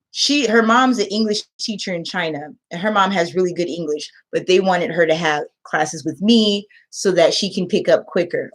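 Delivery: ordinary talk, nothing marked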